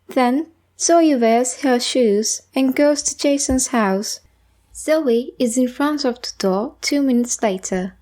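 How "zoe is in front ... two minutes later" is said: plain reading voice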